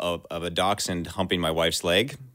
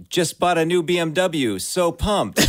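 high excited bro brag; hammed-up bragging; 11 semitones higher, 4 dB louder than usual. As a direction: read it like a gloating bro. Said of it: "updated my Facebook status to".

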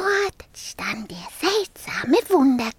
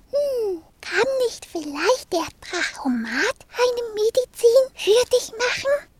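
put-on higher thoughtful little girl; a small girl suggesting, pitched up; 6 semitones higher than usual. Marie proposes medicine from the Drachomat, high girl voice.

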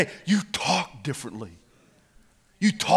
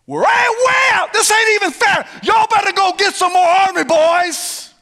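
very high, loud hollering; cocky taunt; 9 dB louder than usual. Acted. Two outnumbered fighters taunting an army, high holler. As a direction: holler it like a comic battle taunt.

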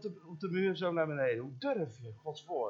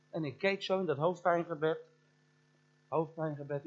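ordinary talk

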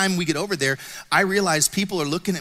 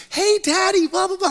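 put-on high little-girl voice calling Daddy; child's voice; 13 semitones higher, 4 dB louder than usual. Four-year-old daughter calling her daddy, high child voice.

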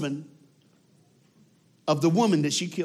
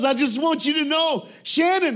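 high, loud, earnest; over the top; 12 semitones higher, 6 dB louder than usual. Retold or imitated. Gary speaking to Shannon, high and intense. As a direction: reach out to her, raised and intense. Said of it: "he's over the top".

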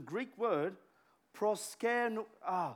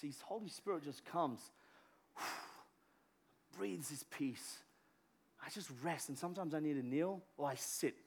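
peaceful hush; 4 semitones lower, 8 dB quieter than usual. Real, not acted. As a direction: breathe it out gently. Calm closing about peace.

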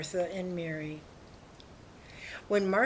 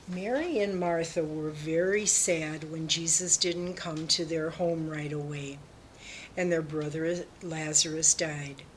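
ordinary delivery

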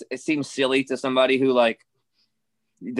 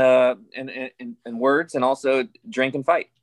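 ordinary delivery

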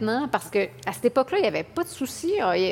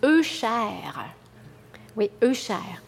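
ordinary talk

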